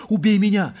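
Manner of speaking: high, loud despairing cry; anguished high plea; desperate plea. A narrator's reading. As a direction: beg desperately at full voice. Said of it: "просила в отчаянии Елена".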